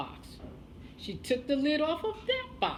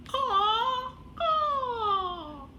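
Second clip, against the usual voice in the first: raven caw; 12 semitones higher than usual. Acted. Raven becomes raven again.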